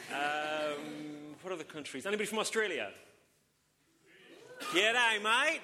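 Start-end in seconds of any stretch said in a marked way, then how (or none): 4.7-5.6 loud, higher hammed-up Aussie "G'day, mate"; Australian accent. Pastor greeting Australians in the congregation, hammed-up Australian accent. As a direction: belt out a jokey Australian greeting. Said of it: "I do that every time".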